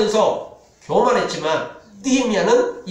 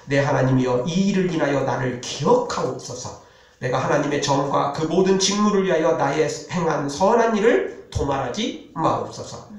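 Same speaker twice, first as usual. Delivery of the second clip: lower, measured reading of a prayer from the Bible; scripture reading; 5 semitones lower than usual. Acted. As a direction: read the prayer like a humble petition. Reads Nehemiah's prayer aloud. (낮고 차분한 성경 낭독)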